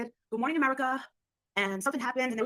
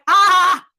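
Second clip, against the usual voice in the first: loud, high, short yell; mock scream; 6 semitones higher, 15 dB louder than usual. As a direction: let out a big hammed-up "ah". Her retold gleeful scream.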